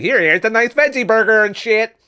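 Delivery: high, loud, sugary mock offer; mock vegan offering. Vegan offering a veggie burger to a starving man, high and mocking. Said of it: "some fucking vegan walking up to you".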